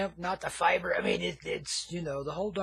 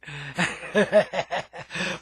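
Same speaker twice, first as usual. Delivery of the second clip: a rolling, voiced stoner chuckle; 3 dB louder than usual. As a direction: chuckle at your own scam joke. Stoner host laughs at own joke.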